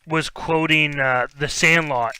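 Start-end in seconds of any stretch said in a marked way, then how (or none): none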